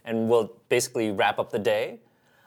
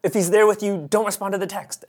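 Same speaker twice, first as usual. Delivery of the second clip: higher, louder, breathless imitation; mock frantic excitement; 8 semitones higher, 4 dB louder than usual. Fiancée texting her best friend, mock frantic and higher. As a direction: impersonate a frantic girlfriend.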